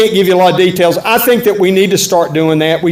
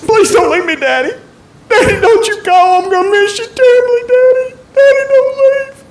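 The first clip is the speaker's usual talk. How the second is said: put-on high, wailing little girl's voice begging; a sobbing seven-year-old clinging to daddy; crying child. Little daughter clinging to departing daddy, high crying plea.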